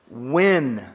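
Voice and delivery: When said alone, low and falling; stressed when. Real, not acted. Preacher contrasting when with if, low and pointed. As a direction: land on When as the key word.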